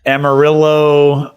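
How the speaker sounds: Amarillo with a hard English L; Amarillo, slow and anglicized, "-rillo" like "pillow"; Texan mispronunciation. Shown as a demonstration. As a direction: say Amarillo the Texan way, drawn out, L sounded. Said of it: "they mispronounce every Mexican thing".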